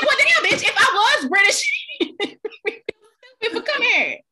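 high, loud mock outrage; hammed-up indignant retort; indignant comeback. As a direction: fire back, half laughing. Retold indignant comeback to the cashier.

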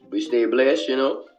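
normal talking voice

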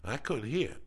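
ordinary talk, nothing marked

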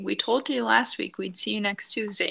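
ordinary talk

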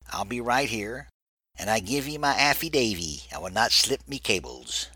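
lightly voiced pirate brogue; a hint of an old sea dog; pirate accent. Benson promising to stay put, mild pirate voice. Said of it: "in his Long John Silver's accent".

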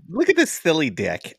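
high, playful cooing tease; mock-innocent amusement; imitated innocent woman.

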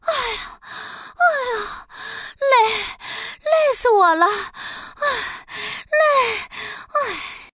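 huffing and puffing between words; panting. Acted. Princess panting after fanning hard, out of breath. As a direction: gasp out the words, worn out. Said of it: "喘着气说".

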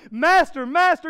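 loud, high panicked yell. Retold or imitated. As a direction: shout it over the storm. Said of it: "one disciple yelling".

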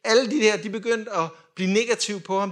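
normal talking voice

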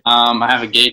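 ordinary talk, nothing marked

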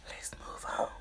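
soft breathy whisper. Real, not acted. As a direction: whisper it to yourself. Whispered aside before the next topic.

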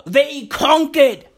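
ordinary delivery

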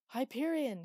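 loud, high call across the void; shouting a name. A narrator's reading. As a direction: shout the name out into empty space. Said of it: "he shouted into the slow".

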